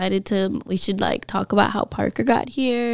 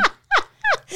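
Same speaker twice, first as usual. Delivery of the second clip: high shriek laugh; 3 dB louder than usual. Real, not acted. Listener laughs at bad mom.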